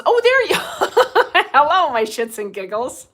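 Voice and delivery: high laughing hello; laughing greeting. Real, not acted. Host greeting a viewer by name, laughing.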